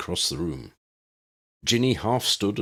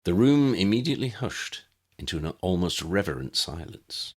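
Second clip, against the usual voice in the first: ordinary delivery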